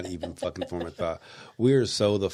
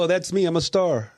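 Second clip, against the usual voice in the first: high, loud starstruck delight; mock-thrilled, pitched way up; excited imagined listener; 11 semitones higher, 8 dB louder than usual. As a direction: gush it like a thrilled fan. Voicing an excited listener recognizing self.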